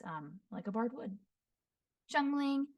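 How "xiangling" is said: ordinary talk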